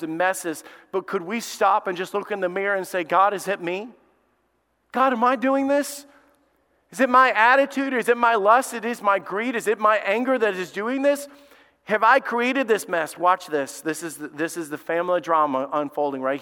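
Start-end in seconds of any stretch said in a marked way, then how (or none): 3.0-13.2 searching prayer. Acted. Imagined prayer of self-examination.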